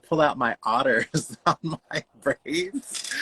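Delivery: slow, giggly delivery; laughing while talking. Real, not acted. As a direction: laugh breaking through, drawn out. Laughing while telling mishap.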